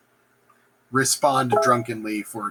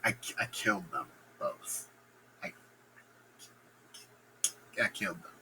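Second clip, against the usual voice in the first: much softer, low, hesitant; sheepish mumble; 5 semitones lower, 15 dB quieter than usual. Acted. Macbeth admitting the killings, sheepish soft mumble. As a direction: admit it under the breath. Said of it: "he's like".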